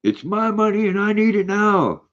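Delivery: very high, whiny ad catchphrase; imitating the famous money commercial; commercial impression. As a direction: squeal it like the TV ad character. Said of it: "I love that commercial".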